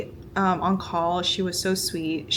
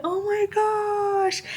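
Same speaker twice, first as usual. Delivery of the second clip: high, slow, overwhelmed oh my gosh; tearful awe; 13 semitones higher, much slower than usual. Retold or imitated. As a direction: breathe it out, moved to tears at the first sight of her. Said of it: "And I was crying".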